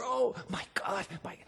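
soft, broken whimper; a faint sob, the view he rejects; hushed "Oh, my God, my" barely voiced. Shown as a demonstration. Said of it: "a sob, a whimper".

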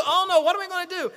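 high-pitched pretend fretting; mock panic. Preacher voices people with no water to drink, mock panic. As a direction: wail it lightly like a helpless doubter.

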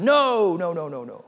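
playful mock refusal; teasing bully. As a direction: taunt the little kid with a string of noes. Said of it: "I like to bully people".

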